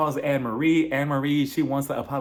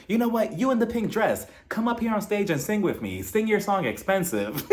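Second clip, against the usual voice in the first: higher, mock-gracious star calling someone up; imagined singer's invitation; 7 semitones higher than usual. Singer invites woman in pink dress onstage, higher mock voice. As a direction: imitate a performer calling a fan onstage. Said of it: "[name] was gonna be like".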